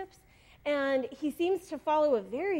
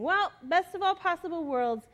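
higher, mock breezy optimism; big smile; 6 semitones higher than usual. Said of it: "with a big smile and saying".

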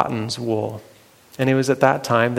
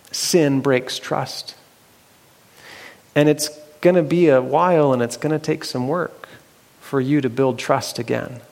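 usual speaking voice

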